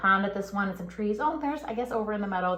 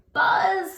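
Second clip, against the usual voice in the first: high, buzzy call; mother bee calling to her babies; buzzing mother bee; 12 semitones higher, 6 dB louder than usual. Acted. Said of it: "said the mother".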